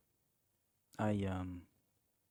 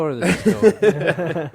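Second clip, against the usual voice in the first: loud, higher, breaking into laughter; laughing; 7 semitones higher, 11 dB louder, much slower than usual. Real, not acted. Host guessing where the driver's story is going, loud and laughing. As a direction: laugh as you say it.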